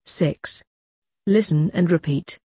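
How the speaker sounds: ordinary delivery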